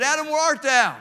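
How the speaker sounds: loud, high call across the garden; God calling out. Retold or imitated. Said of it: "God called out and said".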